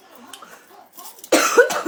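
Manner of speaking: loud, high choking cough; sudden coughing fit, gasping. Real, not acted. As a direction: hack and choke for real. I'm choking.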